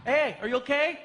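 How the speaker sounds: normal talking voice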